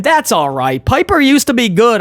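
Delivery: usual speaking voice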